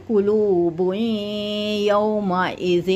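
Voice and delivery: slow, drawn-out Quranic recitation. Shown as a demonstration. Teacher recites the verse slowly for the student. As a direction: chant each syllable long and measured.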